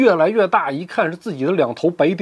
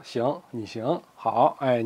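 low, soft, bitten-back words; grudging concession; 7 semitones lower, 8 dB quieter, slower than usual. Acted. Ouyang Feng giving in to the pair, low and grudging. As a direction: swallow the anger, quietly.

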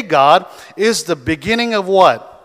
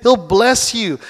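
usual speaking voice

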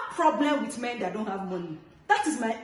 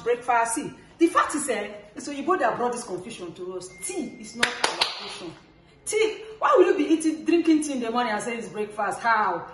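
normal talking voice

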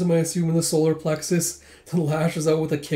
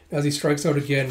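plain reading voice

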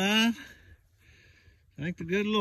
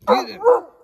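sharp call to dog; 6 dB louder than usual. Real, not acted. Urging hound up tree.

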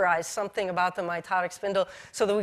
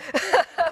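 short laugh; 9 semitones higher, 4 dB louder than usual. Real, not acted. Laughs about a coughing fit.

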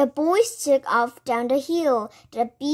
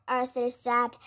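usual speaking voice

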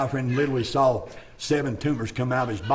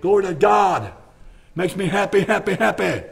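higher, louder, stretched preaching with a music bed; preaching at full force; 6 semitones higher, 5 dB louder than usual. Acted. Mountain preacher urging young mothers, full-force preaching. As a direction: preach it hard and rising to the amen.

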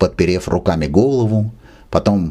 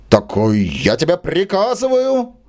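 pitched-up, moderate mock shout of an order; 10 semitones higher than usual. Pale Брыкович shouts at the corridor servant. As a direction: bark the command at the servant. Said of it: "крикнул Брыкович бледнее".

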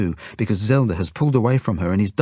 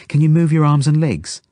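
higher, louder, mildly worried questioning; anxious; 8 semitones higher, 6 dB louder than usual. A narrator's reading. Genya checks the injured boy's limbs, anxious. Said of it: "Genya asks me anxiously".

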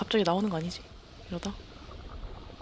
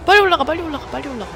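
panicked yelling; 7 semitones higher, 10 dB louder, much faster than usual. Real, not acted. Urging escape from sharks.

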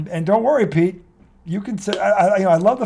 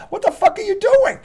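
incredulous laughing yell; exasperated outburst; 13 semitones higher than usual. Real, not acted. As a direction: pitch shoots way up in disbelief, laughing. Fuming at the team's play calls.

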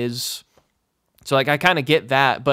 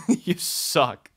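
laughing jab. Real, not acted. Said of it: "repeats with laugh".

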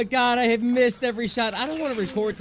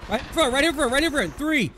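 urgent callout; 6 semitones higher than usual. Real, not acted.

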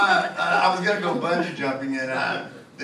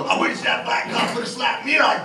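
slow, louder, higher mock voice with a laugh; 7 semitones higher, 3 dB louder than usual. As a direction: ham up the wistful driver, laughing.

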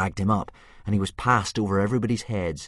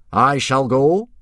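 loud, high heroic announcement; volunteer grandly as the hero; noble and dignified; 7 semitones higher, 12 dB louder, slower than usual. Acted. Gobber volunteers to be hero of the hour, trying to sound noble. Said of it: "He was trying to sound noble and dignified".

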